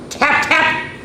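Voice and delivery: bright high knocking sounds; knocking tap tap. A narrator's reading. Reading to kids, she voices the knock high.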